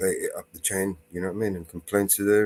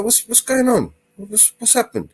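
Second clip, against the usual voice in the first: puzzled husband; 13 semitones higher than usual. Retold or imitated. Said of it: "he's like".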